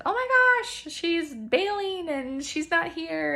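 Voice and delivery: high, loud mock panic, slowed at the end; hammed-up fretting viewer voice; mock worried viewer. Viewer imagining she quit, in mock panic.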